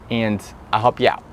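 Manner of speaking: ordinary delivery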